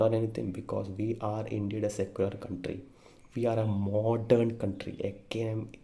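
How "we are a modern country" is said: "modern" drawn out slowly for weight; slow, deliberate stress on "modern"; emphasis on modern. Real, not acted. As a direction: lean hard on the word "modern". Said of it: "I'm emphasizing the word modern".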